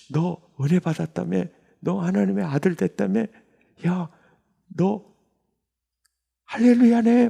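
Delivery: trees cheering him on, building to a shout; joyful trees. Pretend. 가로수들이 축하하는 상상. (가로수들이 은혜 받은 그를 축하하며 점점 높아지는 환호)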